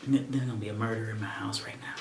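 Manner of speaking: whispered annoyed threat; irritated whisper. Real, not acted. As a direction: hiss it under your breath. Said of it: "Forgive me for the sound".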